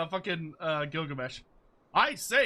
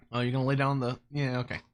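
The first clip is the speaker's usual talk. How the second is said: ordinary talk, nothing marked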